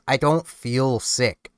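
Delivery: ordinary talk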